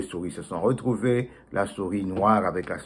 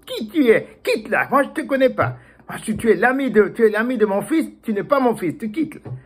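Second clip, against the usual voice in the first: high, louder chasing away; angry father; 11 semitones higher, 4 dB louder than usual. Acted. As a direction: shoo the stranger off. Father chases off stranger.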